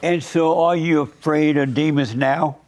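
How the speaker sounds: ordinary delivery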